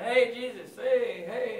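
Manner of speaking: hollering. Retold or imitated. Blind man hollering at Jesus on the road, loud. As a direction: call out to get attention. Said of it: "the blind guy starts hollering".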